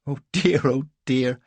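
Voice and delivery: high, loud, amused lady; helpless amusement. A narrator's reading. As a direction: overcome by amusement. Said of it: "lovely Rosanna's helpless laughter".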